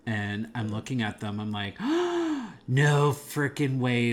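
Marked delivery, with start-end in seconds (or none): 1.8-2.6 high, loud acted gasp; shocked gasp. Moviegoer spotting cameos in the bar scene, shocked gasp. 2.7-4.1 loud, drawn-out amazement; amazed disbelief. Retold or imitated. Moviegoer reacting to surprise cameos, amazed disbelief. Retold thrill at spotting cameos.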